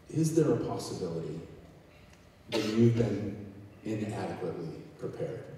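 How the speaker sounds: usual speaking voice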